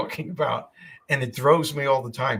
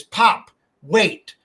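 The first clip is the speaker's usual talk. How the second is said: voicing the granddaughter; 5 semitones higher, 6 dB louder than usual. Granddaughter voiced in story.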